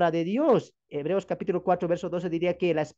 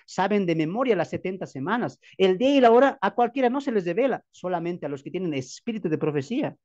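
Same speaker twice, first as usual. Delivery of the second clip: normal talking voice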